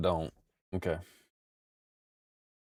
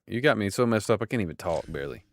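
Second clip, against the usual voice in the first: ordinary delivery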